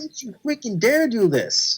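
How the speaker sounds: high, drawn-out mock warning; hammed-up high protest; retold outraged plea. Repeats his cry at the screen.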